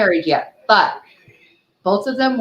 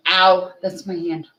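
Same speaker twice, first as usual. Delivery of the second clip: ordinary talk, nothing marked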